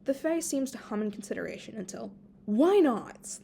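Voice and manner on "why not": bright, loud burst of agreement; exclaiming. A narrator's reading. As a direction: pop it out loud, eager to say yes. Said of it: "Lilia exclaims".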